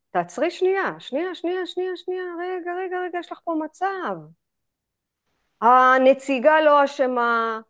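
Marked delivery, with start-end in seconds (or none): 0.1-4.2 high, soft hushed voice easing herself down; soft self-calming. Retold or imitated. Teacher retelling her insurance call, hushed and higher. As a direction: coax yourself to pause, softly. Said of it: "ואז אמרתי".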